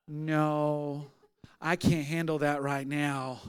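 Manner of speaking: low, soft and slow, imitating an overwhelmed woman; understated distress, drawn out softly; subdued refusal. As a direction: retell a quiet, pained refusal. Retold upset woman declines prayer.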